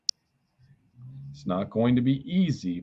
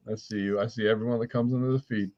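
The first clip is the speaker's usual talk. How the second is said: normal talking voice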